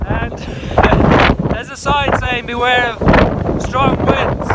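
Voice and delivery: loud, high shout into gale; shouting over wind. Real, not acted. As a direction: yell over howling wind. On windy hilltop in hurricane winds.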